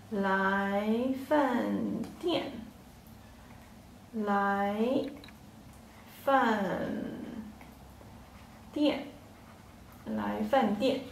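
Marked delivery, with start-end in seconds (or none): 0.1-9.0 来饭店 drawn out, then 来, 饭 and 店 each alone with long pauses; slow, syllable by syllable. Shown as a demonstration. Teacher slowly splits 来饭店 into syllables for learners. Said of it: "Yeah, next word".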